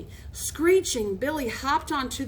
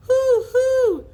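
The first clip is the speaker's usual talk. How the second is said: high, loud owl-like hoots; crazed hooting; 13 semitones higher, 9 dB louder than usual. A narrator's reading. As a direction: hoot like a mad bird. Billy hooting while flapping about.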